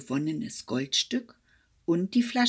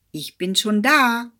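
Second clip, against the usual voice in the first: loud triumphant call down the field; 4 semitones higher, 9 dB louder than usual. Acted. Hedgehog's wife calling to the hare, loud and triumphant. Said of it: "rief ihm die Frau des Igels entgegen".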